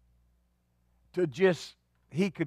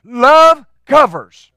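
loud, slow, high proclamation; preaching shout; 7 semitones higher, 13 dB louder, much slower than usual. Real, not acted. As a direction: call it out from the pulpit, drawn out.

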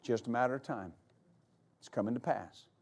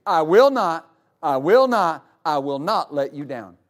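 WILL NOT punched, starting loud and settling lower; emphatic repetition; 4 semitones lower, 5 dB louder than usual. Retold or imitated. Preacher telling the congregation God's promise, stressed WILL NOT. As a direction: hit WILL NOT three times, lowering by the last. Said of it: "He emphasizes that three times".

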